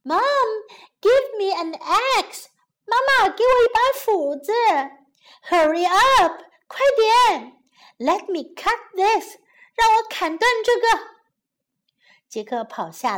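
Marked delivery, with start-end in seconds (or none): 0.0-10.9 high, urgent yell; urgent shout for help on the run; calling out loudly. Acted. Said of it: "边跑边大声说". (急切地大声喊)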